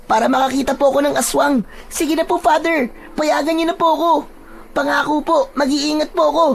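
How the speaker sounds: put-on high, childlike begging; high-pitched boyish plea; pleading young boy. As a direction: coax the priest like an eager kid. Voices Boyet pleading.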